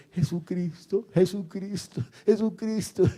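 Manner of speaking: high, bouncy cry of praise; imitating excited praise. Preacher mimics old man jumping like rubber, in a high excited cry. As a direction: mimic someone jumping about, pitch climbing. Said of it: "El hombre saltaba como un caucho ahí".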